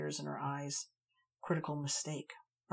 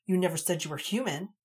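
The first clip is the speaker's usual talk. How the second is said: loud, sharp, higher comeback; angry retort; 3 semitones higher, 7 dB louder than usual. A narrator's reading. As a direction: throw it back at him. Heated duel retort.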